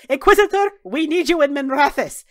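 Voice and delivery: high, loud call to the Inquisitor; shouting Zevran. Acted. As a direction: call out urgently, pitched well up. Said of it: "Don't shout".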